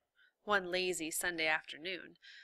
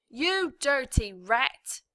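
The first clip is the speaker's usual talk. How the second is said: slow, loud, higher insult; angry shout; 6 semitones higher, 9 dB louder, slower than usual. A narrator's reading. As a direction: spit the insult out. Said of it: "shouted the prisoner".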